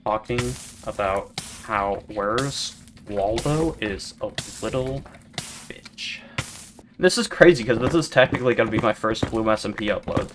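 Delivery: ordinary delivery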